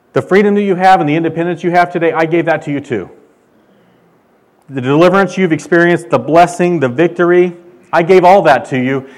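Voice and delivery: loud, proclaiming list of gifts; voicing God. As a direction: declare each gift like God himself speaking. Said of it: "He says".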